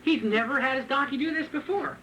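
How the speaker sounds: usual speaking voice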